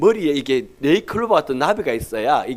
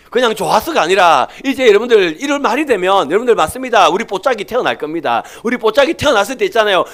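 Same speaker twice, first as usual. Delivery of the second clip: louder, higher pulpit delivery; full-force preaching; 8 semitones higher, 8 dB louder than usual. Acted. Pastor telling the congregation why he talks to the ultrasound, full-force preaching.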